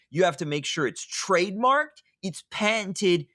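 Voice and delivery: mock-earnest, over-the-top sarcasm; sarcastic. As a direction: pretend it's life or death, dripping sarcasm. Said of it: "and I'm being sarcastic".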